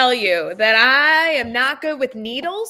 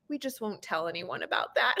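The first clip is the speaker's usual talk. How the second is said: hushed reassurance; 2 semitones lower, 9 dB quieter than usual. Retold or imitated. Parent hushing after tears.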